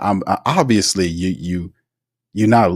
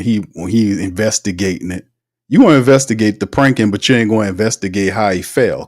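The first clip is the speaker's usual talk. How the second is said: ordinary delivery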